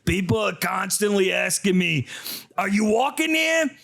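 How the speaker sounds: hammed-up New York accent, pushed up high. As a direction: holler it like a cartoon New Yorker. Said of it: "gonna have to read this in an accent".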